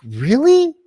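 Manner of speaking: high, loud mock disbelief; incredulous. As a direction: scoff in disbelief. Mocking the absurd situation.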